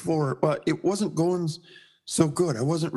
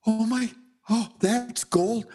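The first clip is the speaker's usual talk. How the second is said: high, slow amazement, retold; awestruck wonder; 8 semitones higher, slower than usual. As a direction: marvel as if seeing gold leaf first time. Retold awe at gold leaf.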